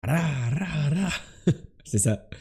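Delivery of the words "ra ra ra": "Ra, ra, ra" with trilled R; rolled R. Shown as a demonstration. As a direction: roll the R in each "ra". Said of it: "En roulant bien les R".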